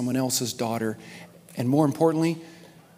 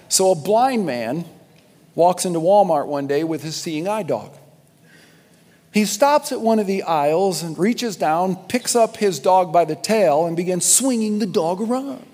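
joke telling; 4 semitones higher, 6 dB louder than usual. Real, not acted. Preacher tells a silly joke.

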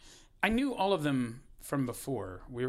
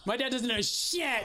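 much higher laughing imitation of a snotty kid; mocking teen; 11 semitones higher than usual. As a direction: dismiss dad like a teenager. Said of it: "My kid went".

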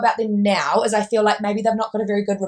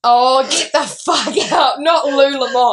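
laughing disbelief; 4 semitones higher, 5 dB louder, slower than usual. Real, not acted. Hearing the sister's mispronunciation.

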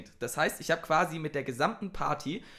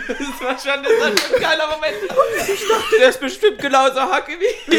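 higher and louder, laughing through words; 11 semitones higher, 11 dB louder than usual. Real, not acted. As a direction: talk while cracking up. Laughing while telling.

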